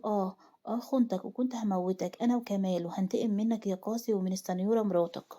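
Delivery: ordinary delivery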